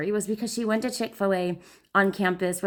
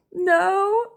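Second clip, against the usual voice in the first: much higher, louder sob; imitating sobbing no; 11 semitones higher, 6 dB louder than usual. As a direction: wail the no.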